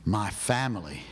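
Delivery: ordinary talk, nothing marked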